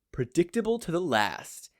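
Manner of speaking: droned. A narrator's reading. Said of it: "he droned".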